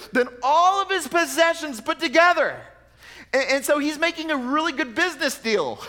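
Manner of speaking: high, loud pulpit build-up with a laugh; impassioned preaching. Acted. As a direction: preach it out with swelling conviction.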